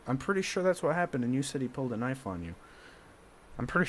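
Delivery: ordinary talk, nothing marked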